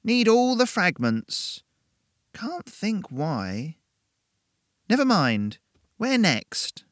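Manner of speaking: put-on high, slow fussy old woman. A narrator's reading. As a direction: fuss like a muddled old lady. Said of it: "then tutted".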